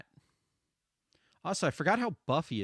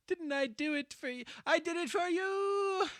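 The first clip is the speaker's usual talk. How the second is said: mock hurt whine; 11 semitones higher than usual. Mocking her own in-game line.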